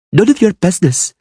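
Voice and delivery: put-on high, sulky child's voice; a snotty brush-off; sniffing girl. A narrator's reading. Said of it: "she sniffed".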